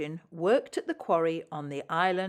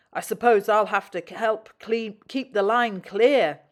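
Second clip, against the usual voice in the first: loud grudging grumble; complaining; 3 semitones higher, 8 dB louder than usual. A narrator's reading. Lorry grumbling about the line, loud mock grumble. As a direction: pretend you mind. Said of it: "Elizabeth complained".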